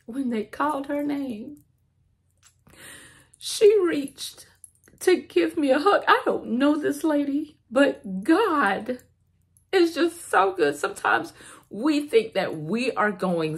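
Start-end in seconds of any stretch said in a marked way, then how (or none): 0.1-1.6 choked up, higher and softer, close to tears; voice breaking. Real, not acted. Telling a stranger's kindness, voice breaking into tears. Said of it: "And I'm crying just like I am right now". 3.4-10.8 tearful, voice high and cracking; crying. Real, not acted. Praising God for a stranger's hug, crying. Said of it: "And I'm crying just like I am right now".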